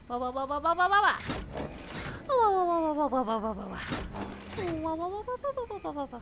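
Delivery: soft wah-wahs sliding up and down; playful wah-wah noise. Pretend. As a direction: babble wahs in a rising and falling swoop.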